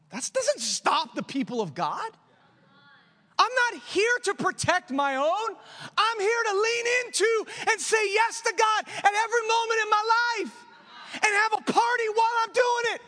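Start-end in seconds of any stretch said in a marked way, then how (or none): 3.4-13.0 full-force shout, much higher and louder; shouted preaching. Acted. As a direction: shout it out like a revival climax. Preacher's climactic declaration.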